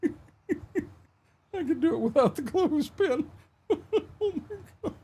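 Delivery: high, giggling through the words; can barely get it out for laughing. Real, not acted. Cracking up at own joke.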